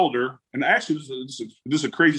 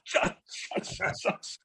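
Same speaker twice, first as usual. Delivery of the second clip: whispered shushing; 8 dB quieter, much slower than usual. Retold or imitated. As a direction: hush him in a whisper. Hushing dad during the draft call.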